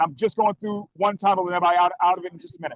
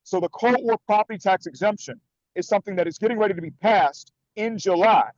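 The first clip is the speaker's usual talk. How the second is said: ordinary talk, nothing marked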